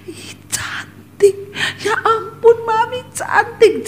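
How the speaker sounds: put-on high breathy child voice gushing over mom; kids gushing. Children admiring their mother's long hair, gushing high voice. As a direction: coo like an amazed little kid.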